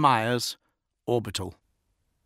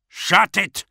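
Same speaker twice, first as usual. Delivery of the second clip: higher, louder order; barked order; 11 semitones higher, 8 dB louder than usual. A narrator's reading. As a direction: bark the order. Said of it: "ordered the officer".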